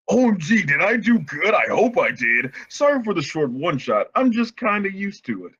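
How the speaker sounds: hammed-up excited author voice, pitch swooping high; mock-giddy, jumpy author's note; gushing fanfic author. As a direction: read it like an over-eager fan writer. Reads author's notes in role.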